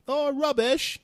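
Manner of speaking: high, loud mocking "rubbish"; mocking imitation. Americans making fun of his word "rubbish", high and loud. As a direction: tease him for saying "rubbish". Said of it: "they make fun of me".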